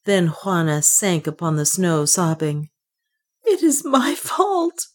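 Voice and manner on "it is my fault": high, drawn-out despairing wail. A narrator's reading. Juana blames herself after the loss, high wailing. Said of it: "she wailed".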